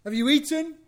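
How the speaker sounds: mock caring mother checking on her son; fussing mother. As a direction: gush over the lost son with concern. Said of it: "there's not like".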